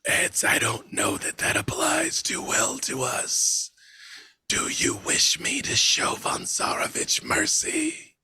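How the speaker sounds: eerie whispered voice of Death; whispering Death. Acted. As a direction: breathe it out like a dread reaper.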